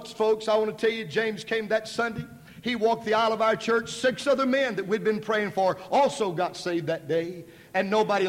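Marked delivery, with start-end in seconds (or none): none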